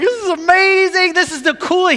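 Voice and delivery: put-on high, thrilled kid voice; the son thrilled after the dogs kill the rat; excited kid. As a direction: gush like a boy who just saw something awesome. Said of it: "Luke is like".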